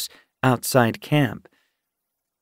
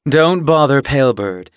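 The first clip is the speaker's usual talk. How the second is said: firm, louder warning; loud, higher-pitched word of caution; 4 semitones higher, 7 dB louder than usual. A narrator's reading. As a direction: warn someone off, raised voice. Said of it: "she warned".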